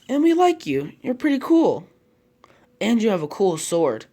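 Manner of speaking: put-on high, excited kid-like praise; eager, loud admiration; admiring little cousins. A narrator's reading. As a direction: gush with lifted voice.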